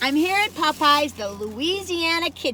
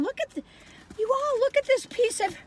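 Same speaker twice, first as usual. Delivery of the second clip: excited; 12 semitones higher than usual. Real, not acted. Amazed at the chicken breast.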